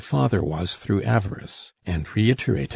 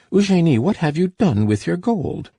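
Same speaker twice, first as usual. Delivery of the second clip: much higher, louder demand; angry cry; 9 semitones higher, 4 dB louder than usual. A narrator's reading. Furious father demanding his daughter's gold, higher and louder. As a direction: rush at her shouting the question. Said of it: "he cried".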